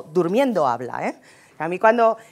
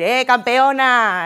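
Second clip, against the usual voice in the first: mock hearty greeting; 4 semitones higher, 6 dB louder, much slower than usual. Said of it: "tendré que saber que no puedo ir".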